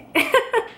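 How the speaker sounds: a quick high burst of laughter; high giggle. Real, not acted. Laughing at her own hurricane panic, a high giggle. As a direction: giggle at yourself.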